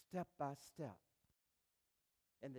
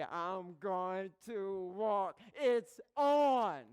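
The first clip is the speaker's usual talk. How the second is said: triumphant; 3 semitones higher, 8 dB louder, much slower than usual. Retold or imitated. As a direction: announce it like a victory. Triumphant after falling.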